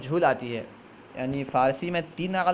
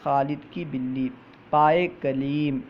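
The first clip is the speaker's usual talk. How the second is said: normal talking voice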